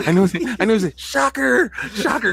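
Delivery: high-pitched put-on voice of scandalized fans; mock shocked fans. He teases the ponies about his game, high mock-shocked voice. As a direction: squeal in mock dismay.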